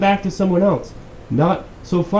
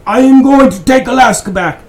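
higher and much louder mock declaration; Putin declaring; 9 semitones higher, 10 dB louder than usual. Imagining Putin making a real threat, a loud mock declaration. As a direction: boom it out like a dictator's threat. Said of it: "if it was Putin saying".

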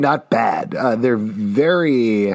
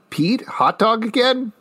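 teasing; 11 semitones higher than usual. Real, not acted. Teasing question to friend.

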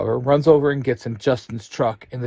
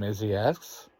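ordinary delivery

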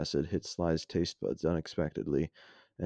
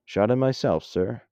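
plain reading voice

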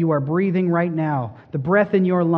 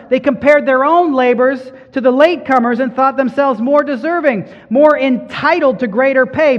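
louder, higher pulpit preaching; preaching at full force; 7 semitones higher, 5 dB louder than usual. Acted. Pastor pressing the parable on the congregation, preaching hard. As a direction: drive the point home to the congregation.

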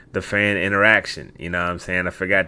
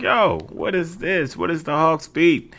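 confused listener; 10 semitones higher than usual. Retold or imitated. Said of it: "you're like".

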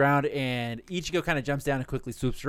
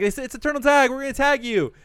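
gleeful taunt; 8 semitones higher than usual. Retold or imitated. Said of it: "And they're like".

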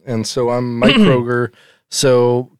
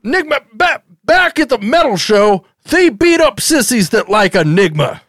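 higher-pitched scolding father parody; mock angry dad; 7 semitones higher than usual. Father ordering Vinny to become a metal drummer, in a higher mock-angry voice. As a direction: lecture him like a fed-up sitcom dad.

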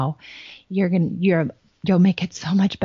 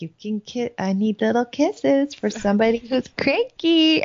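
sing-song mom; 6 semitones higher than usual. Retold or imitated. Mother cooing at her cranky daughter, high sing-song. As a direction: coo it sweetly, teasing a grumpy kid. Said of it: "and I'm like".

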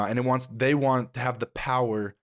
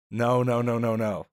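normal talking voice

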